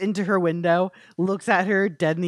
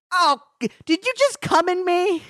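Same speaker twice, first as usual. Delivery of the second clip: much higher startled question; high Bella voice; 11 semitones higher than usual. Retold or imitated. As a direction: call it out high and shocked.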